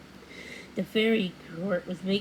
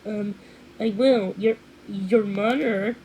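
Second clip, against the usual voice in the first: shocked stammering; 2 semitones higher, 6 dB louder than usual. A narrator's reading. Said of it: "too shocked to make such sense".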